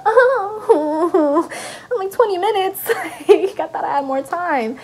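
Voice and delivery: high, whimpering dismay with laughs; mock whimper. Woman retelling her shock at the twenty-minute warning, mock whimpering. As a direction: whimper it, half laughing at yourself.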